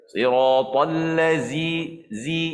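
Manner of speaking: siratal ladzi slowly chanted with zai instead of zal; demonstrating the invalid zai in ladzi; wrong recitation. Shown as a demonstration. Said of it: "Itu juga tidak sah salat".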